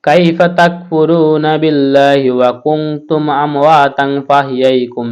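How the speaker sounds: melodic chant, slowing to a drawn-out close; Quran recitation. Acted. Teacher recites the full verse for students. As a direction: recite it tunefully, stretching the end.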